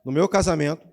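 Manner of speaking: loud, stress on meu; loud declaration. Shown as a demonstration. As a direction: proclaim it loud to the church. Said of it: "fala alto aí". (declaração alta da congregação)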